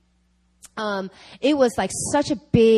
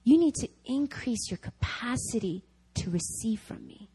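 much softer, a quiet inner voice; God's gentle voice; 8 dB quieter than usual. Retold or imitated. God gently confronting the doubting speaker, in a soft voice. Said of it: "and he goes".

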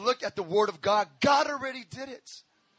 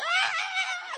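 high shrieking scream with a laugh; 1 kids' scream; 4 dB quieter than usual. Retold or imitated. Kids one-upping each other, high shriek. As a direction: shriek it like a kid.

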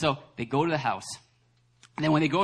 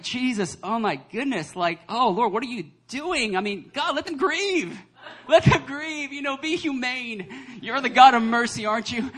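high, mock-appalled protest; exasperated pleading; 7 semitones higher, 5 dB louder, slower than usual. Reader begging Jesus for mercy on mourners, exasperated. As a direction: plead in comic disbelief, rising to a cry.

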